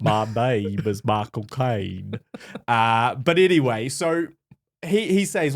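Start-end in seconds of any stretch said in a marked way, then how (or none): 0.0-2.2 slow, low, stretched-out impression; drawling celebrity impression sliding off target; Michael Caine. Co-host repeating his impression after admitting it slipped, slow low Michael Caine. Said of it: "verging on Forrest Gumpish".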